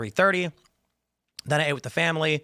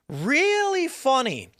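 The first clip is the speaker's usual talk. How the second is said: drawn-out, high-pitched mock amusement; sarcastic retort; 11 semitones higher, much slower than usual. Host retelling his jab at ChatGPT, sarcastic. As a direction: stretch it high and slow, dripping sarcasm.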